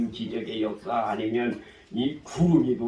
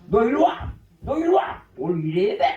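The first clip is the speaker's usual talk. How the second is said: higher, louder shouted order; bossy shout; 10 semitones higher, 4 dB louder than usual. Retold or imitated. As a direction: yell at him to come over. Older brother ordering him. (형이 동생에게 소리치는 명령)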